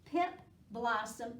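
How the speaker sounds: plain reading voice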